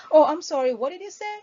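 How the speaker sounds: distracted, caught-off-guard "what did you say?"; inattentive reply. Shown as a demonstration. Trainer showing an inattentive agent, distracted. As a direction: sound like you weren't listening. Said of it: "that shows you are not paying full attention".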